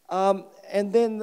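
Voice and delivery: ordinary delivery, nothing marked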